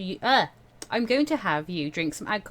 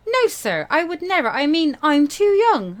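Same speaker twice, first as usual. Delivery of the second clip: Winter protesting; 8 semitones higher, 8 dB louder than usual. Acted. Winter protests to her mentor.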